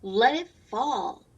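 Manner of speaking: lively, loud read-aloud refrain; reading to children. A narrator's reading. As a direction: read the refrain brightly for the class. Reads picture book aloud.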